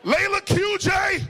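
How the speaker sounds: high, loud drawn-out name-calling; calling out accusingly. Retold or imitated. Father summons the kids over cussing, hollering. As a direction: holler each child's name like a roll call.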